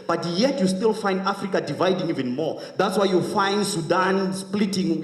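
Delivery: stirring political speech; impassioned oratory. Real, not acted. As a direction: address the hall like a rousing orator. Impassioned oratory on African unity.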